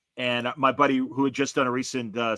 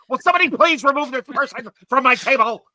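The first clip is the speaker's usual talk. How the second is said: much higher, louder outraged appeal to the room; mock indignant plea; 12 semitones higher, 8 dB louder than usual. Guy demands someone remove the speaker, hammed-up loud plea. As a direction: call out for staff in a huff.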